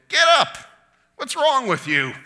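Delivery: put-on high, loud exasperated yell at a toddler; parent scolding toddler. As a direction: yell at a kid throwing a tantrum. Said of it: "two-year-old on the floor of the grocery store".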